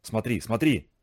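low, hushed amazement; amazed. A narrator's reading. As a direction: marvel under your voice. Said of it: "изумлённо воскликнул отец". (негромкое, низкое изумление)

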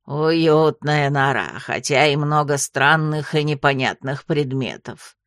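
low, slow croaking toad; slow, deep toad voice. A narrator's reading. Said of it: "сообщила жаба".

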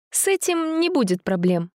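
put-on girl voice. Dasha voiced by narrator.

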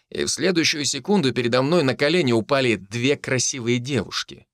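ordinary delivery, nothing marked

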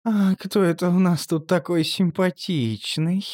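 slow, purring seductive voice. Acted. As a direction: purr it like a cat. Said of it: "промурлыкала Анжела".

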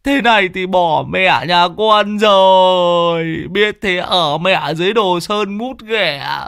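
slow, high comic whimper; whimpering lament. Acted. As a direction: whine it like a man about to cry. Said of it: "Lão Đồng bây giờ mếu máo".

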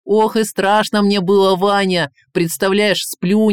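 put-on high, loud, fretful old ruler's lament; excitable tsar, aggrieved. Tsar tells Ivan of his kidnapping, excitedly aggrieved. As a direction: pour out the ordeal in a high, loud voice.

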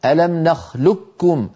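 "نخلقكم" said slowly as nakhlukkum; full assimilation. Shown as a demonstration. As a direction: merge the q of "نخلقكم" wholly into a doubled k. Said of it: "فيكون النطق بكاف مشددة".

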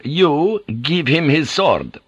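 slow, high, deliberate order; measured, emphatic translation; relayed command. A narrator's reading. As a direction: pass on the command word by word. Interpreter relays a shouted order.

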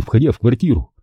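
plain reading voice

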